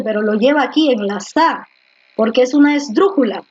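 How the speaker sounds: ordinary talk, nothing marked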